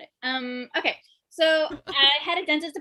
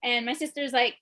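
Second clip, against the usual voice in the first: usual speaking voice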